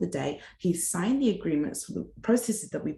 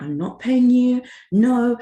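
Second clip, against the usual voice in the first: adamant refusal; 6 semitones higher, 8 dB louder, slower than usual. Retold or imitated. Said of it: "he's still adamant".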